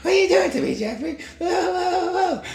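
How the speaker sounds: very high squeaky dog voice, half laughing; voicing the dog. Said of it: "he was like".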